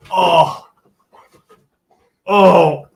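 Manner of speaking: ordinary delivery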